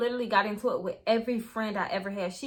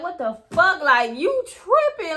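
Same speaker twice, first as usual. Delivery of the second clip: raised, louder, incredulous protest; indignant, with pitch pushed up; retold indignant outburst; 5 semitones higher, 6 dB louder, slower than usual. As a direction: act out snapping at someone who bit you. Retelling reaction to being bitten.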